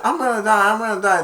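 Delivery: high, loud panicky cry; mock terror while running; panicked. Tad running with the ball, high panicky cry.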